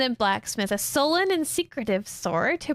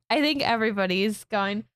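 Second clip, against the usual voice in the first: ordinary delivery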